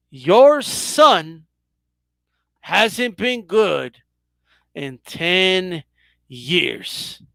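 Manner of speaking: slow, with pauses after "son" and "good"; deliberate parting jab on "ten years"; drawn-out taunt. Pretend. Host's last repeat of taunt.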